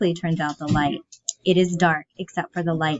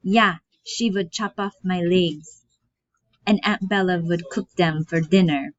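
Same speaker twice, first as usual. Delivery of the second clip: put-on German accent. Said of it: "With German accent".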